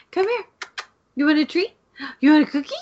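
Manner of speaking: high sing-song coaxing to a dog; pet talk. Real, not acted. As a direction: coax the dog over in a high voice.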